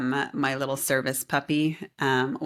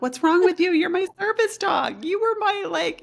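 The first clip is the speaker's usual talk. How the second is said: exasperated scolding; 4 dB louder than usual. Retold or imitated. Scolding her service puppy.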